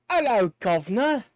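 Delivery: lightly put-on British accent; hammed-up British hello; butchered British greeting. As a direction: have a clumsy go at a British accent. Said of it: "I just butchered that pronunciation".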